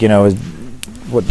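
normal talking voice

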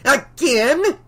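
high, rising cry of disbelief; indignant shout. A narrator's reading. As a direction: shout it as an outraged question. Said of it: "she shouted".

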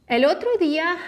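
El otro día lifted high with a rising end; teacher's emphatic repeat. Shown as a demonstration.

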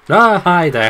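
high, loud fake-friendly hello; mock greeting. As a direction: greet the monster with sarcastic cheer. Greeting game monster mockingly.

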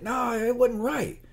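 high-pitched mock customer complaint; imitating an indignant customer; irate customer. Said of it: "people come in there just fight people".

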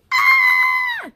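high loud pretend scream; a storybook tantrum shriek; mock angry scream. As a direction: let out a big cartoon yell. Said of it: "and scream".

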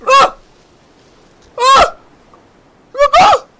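short, high, loud shouts; high-pitched bursts of alarm; alarmed yells. Real, not acted. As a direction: yell out in shock. Alarm during game.

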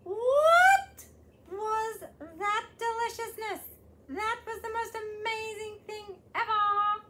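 high, loud, thrilled; amazed. Acted. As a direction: squeal with delight. Caterpillar tastes chocolate cake.

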